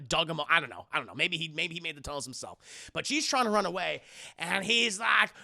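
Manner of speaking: ordinary delivery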